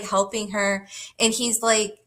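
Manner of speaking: usual speaking voice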